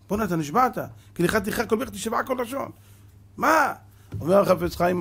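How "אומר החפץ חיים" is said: usual speaking voice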